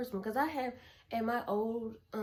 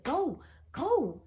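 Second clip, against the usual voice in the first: high, louder urging; urgent go; 6 semitones higher, 6 dB louder than usual. Retold or imitated. Hurrying her friend along, high and loud. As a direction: push him to hurry.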